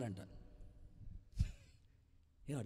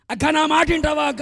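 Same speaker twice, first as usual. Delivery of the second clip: higher, louder earnest plea; 10 semitones higher, 16 dB louder than usual. Acted. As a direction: beg her to listen to you. Pleading with sister. (అక్కను గట్టిగా వేడుకోవడం)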